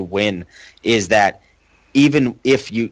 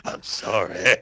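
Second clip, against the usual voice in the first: half-laughing fake sob; mock tearful apology; 3 semitones lower, 5 dB quieter, slower than usual. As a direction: whimper it, badly faking tears. Joking tearful apology to Kenny.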